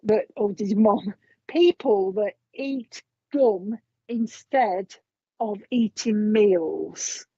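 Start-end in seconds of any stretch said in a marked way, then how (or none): 1.5-7.2 very slow, drawn out, like chewing gum; slow gum-chewing talk. Shown as a demonstration. Passing on the mum's message, slowed right down. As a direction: stretch each word out slowly. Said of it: "Do you talk like this".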